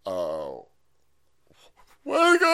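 put-on high whimpering child's cry; mock crying kid. Six-year-olds crying at the teacher's news. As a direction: wail like a scared six-year-old. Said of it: "six-year-olds in the classroom go".

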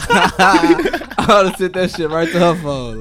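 slow, laughing through it. Real, not acted. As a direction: laugh out the line. Repeat, laughing and slowed.

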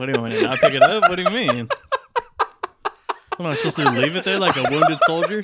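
loud protest through laughter; indignant, cracking up; laughing. Real, not acted. Defending picking up goose ball.

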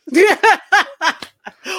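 big laugh after a punchline; loud high laugh. Real, not acted.

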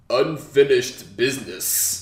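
deadpan tough-guy delivery; drawn-out action hero line; slow gruff movie line. Retold or imitated. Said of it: "He goes".

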